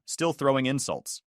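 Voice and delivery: plain reading voice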